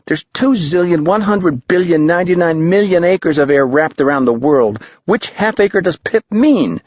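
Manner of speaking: put-on high kid voice reeling off numbers; a boy mocking the vague directions; high young boy, sarcastic. A narrator's reading.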